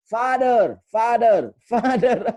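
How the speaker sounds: high, loud repeated father. Retold or imitated. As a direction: hold up the egg and yell father.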